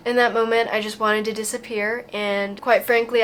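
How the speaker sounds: ordinary talk, nothing marked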